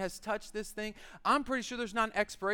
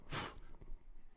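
resigned sigh; 13 dB quieter than usual. Retold or imitated. Resigned sigh picking up LaCroix.

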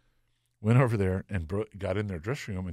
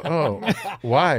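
laughing innocent why; 7 semitones higher, 7 dB louder than usual. Retold or imitated. Told cops are there for him.

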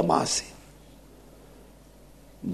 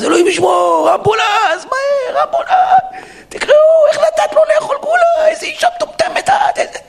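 panicked yelling. Acted. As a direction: scream for an ambulance like your child is choking. Acting panicked father, choking son.

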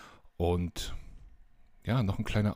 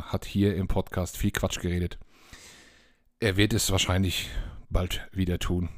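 usual speaking voice

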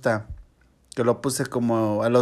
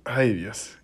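sighing after a drink; recovering from a sip; 3 dB quieter than usual. Real, not acted. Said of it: "estoy tomando un F X aquí".

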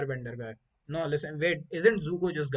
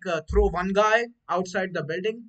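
ordinary talk

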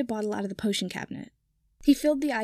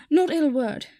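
high, louder panicked protest; quick panicky; 5 semitones higher, 6 dB louder, slower than usual. Acted. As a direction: blurt it out in a panic. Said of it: "Kipper said quickly".

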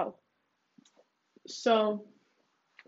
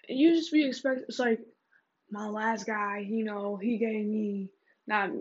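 ordinary delivery

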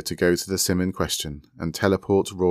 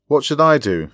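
higher, louder questioning call; 8 semitones higher, 7 dB louder than usual. A narrator's reading. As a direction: call the question across to someone. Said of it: "Lobelia called".

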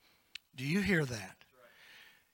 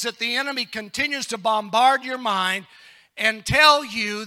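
loud, raised, ringing pulpit exhortation; full-force preaching; 6 semitones higher, 13 dB louder than usual. Real, not acted. Preacher urging the congregation past the enemy's lies, full-force preaching. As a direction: preach it hard, building to a rallying cry.

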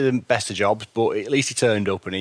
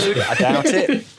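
breaks into a laugh, pitched up, slowed; 10 semitones higher, 4 dB louder, much slower than usual. Real, not acted. Reviewer doubting the other episodes are better, laughing.

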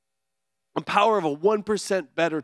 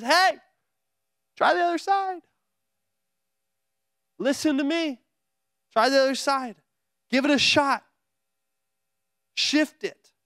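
lightly voiced Jesus, gentle; 6 semitones higher than usual. Jesus' instructions are as simple as.

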